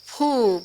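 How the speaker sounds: a short puff of breath, phoo; blowing sound. Shown as a demonstration. Teacher showing Baba's blowing of virtues, a puff of breath. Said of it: "you can just blow".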